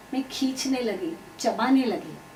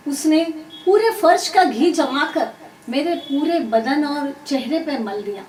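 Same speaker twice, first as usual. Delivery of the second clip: plain reading voice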